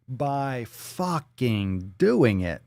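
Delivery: very slow, drawn out; slow deadpan. Acted. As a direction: spell it out word by word. First take, very slow.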